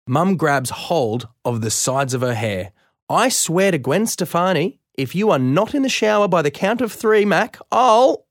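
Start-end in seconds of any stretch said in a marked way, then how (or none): 3.1-8.2 high, rising angry threat; threatening mum. Acted. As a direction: build the threat louder toward the name. Mum threatening, counting to three.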